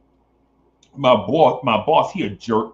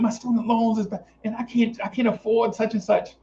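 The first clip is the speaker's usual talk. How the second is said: whiny woman; 8 semitones higher than usual. Retold or imitated. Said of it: "She's like".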